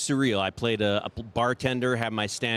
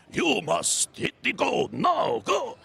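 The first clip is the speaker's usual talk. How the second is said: put-on much higher, slow shouted old-man voice; old sumo wrestler; 10 semitones higher, much slower than usual. A seventy-year-old sumo wrestler voice for a slot machine. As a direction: bellow like an old sumo coach. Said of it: "seventy-year-old sumo wrestler".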